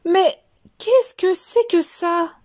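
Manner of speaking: high, slow, shocked question; startled outburst. Acted. Teacher spotting a mouse on the desk, shocked and high. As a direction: recoil at the sight of a mouse.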